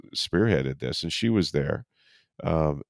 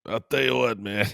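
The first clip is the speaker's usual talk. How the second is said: lightly voiced Gruden with a cold, higher; a hint of the coach's gravelly catchphrase; John Gruden impression; 4 semitones higher than usual. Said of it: "never done John Gruden with a cold".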